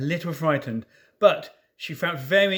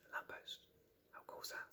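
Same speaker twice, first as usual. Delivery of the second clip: whisper; 29 dB quieter, faster than usual. Real, not acted. Reader's aside to listeners.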